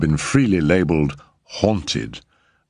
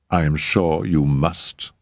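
usual speaking voice

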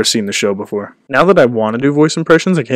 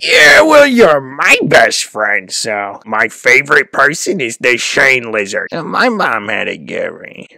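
voice impression; slower than usual. Streamer showing viewers a sample impression, put-on character voice. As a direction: drag the words out in a goofy character voice. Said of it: "re-upped my voice impressions".

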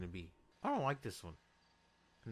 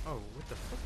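normal talking voice

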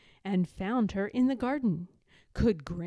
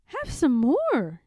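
higher, louder, slow coaxing offer; kindly grandmother; 5 semitones higher, 6 dB louder, slower than usual. A narrator's reading. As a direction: press more food on the cub gently.

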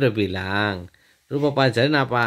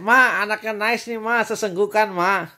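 raised, higher-pitched call; 6 semitones higher, 5 dB louder than usual. Real, not acted. Father reports the sobbing child to Mama, calling out.

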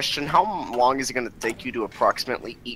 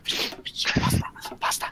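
hushed, voiceless chant; playful whisper; 9 dB quieter than usual. Pretend. Player teasing friends with nonsense, playful whisper. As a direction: whisper it under the others' chatter.

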